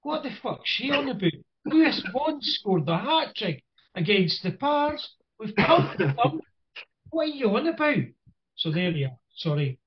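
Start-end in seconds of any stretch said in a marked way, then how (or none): 0.0-8.0 pitched-up incredulous inner rant; disbelieving, exasperated, higher; imitating his own baffled thoughts. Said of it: "in my head, I'm going".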